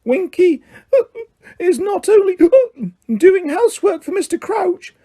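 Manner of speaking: very high, tearful, offended elf squeak; high indignant house-elf voice. A narrator's reading. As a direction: squeak back, hurt and proud. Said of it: "Winky squeaked indignantly".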